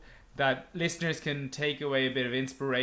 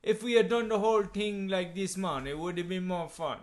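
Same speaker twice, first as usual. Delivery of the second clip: put-on Jamaican accent, lilting slightly higher; 4 semitones higher than usual. Shown as a demonstration. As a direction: do your attempt at a Jamaican accent. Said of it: "lack of Jamaican accent".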